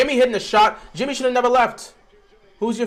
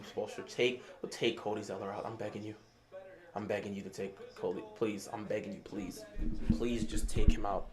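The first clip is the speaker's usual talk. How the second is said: much softer and lower, pleading under the breath; quiet begging; 11 semitones lower, 12 dB quieter than usual. Real, not acted. Fan begging the coach to bench Zeller, quiet pleading. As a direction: beg quietly as if praying.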